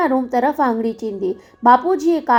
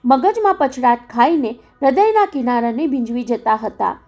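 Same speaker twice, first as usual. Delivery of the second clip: plain reading voice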